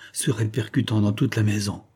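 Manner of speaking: plain reading voice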